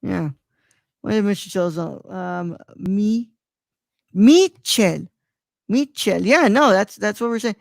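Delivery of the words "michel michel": Michel as mee-CHELL, with CH as in chair; "Michel" carefully split, mee-chell; careful name pronunciation. Shown as a demonstration. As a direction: sound out "Michel" deliberately, then repeat it. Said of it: "if I did that correctly".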